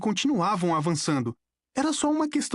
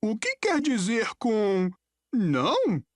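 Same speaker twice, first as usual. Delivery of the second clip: slow, higher-pitched puzzled question; hesitant nervous query, voice raised in pitch; 6 semitones higher, slower than usual. A narrator's reading. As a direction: ask haltingly, a little anxious. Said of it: "disse Grover nervoso".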